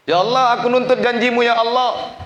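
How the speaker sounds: demanding prayer, pitched far above normal speech; retold prayer at full force; impassioned plea. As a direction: cry out to God, high and loud. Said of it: "saya bilang sama Allah".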